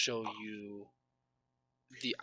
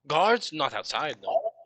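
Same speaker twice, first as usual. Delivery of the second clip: surprised NPC; 4 semitones higher, 7 dB louder than usual. Acted. GM voices an NPC's surprise.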